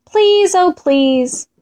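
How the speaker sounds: high, slow begging; pleading. A narrator's reading. Fox and Mole ask Condor to fly, pleading. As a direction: draw out a sweet plea.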